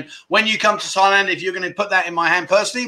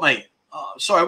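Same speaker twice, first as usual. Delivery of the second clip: ordinary talk, nothing marked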